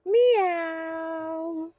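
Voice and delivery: high, soft kitty meow; cat meow. Pretend. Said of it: "What does the cat say?".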